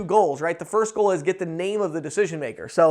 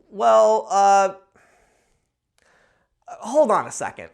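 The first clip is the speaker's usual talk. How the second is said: flustered gatekeeper; 4 dB louder, slower than usual. Retold or imitated. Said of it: "they'll kinda look at me like".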